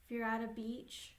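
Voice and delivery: unhurried meditation voice with long pauses; guided meditation. Real, not acted. Therapist leading clients into an inner peaceful place, guided meditation. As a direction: guide them gently, leaving space between phrases.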